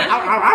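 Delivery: loud high yelping; hurt wolf yelps. Pretend. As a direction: yelp like a bitten wolf. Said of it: "被咬到的大野狼".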